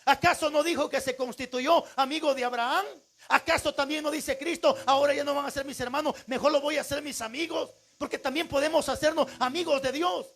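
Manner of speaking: high, forceful preacher's delivery; preaching. Real, not acted. Preacher urging congregation to talk to God, forceful preaching.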